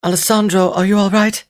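high, anxious female voice; concerned woman checking on him; worried woman. Acted. Cassini worried about fallen date.

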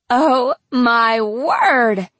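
high, loud, drawn-out shriek; stretched-out squeal down the phone; near shriek. A narrator's reading. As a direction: shriek each word slowly in disbelief. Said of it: "she pretty much shrieked into the phone".

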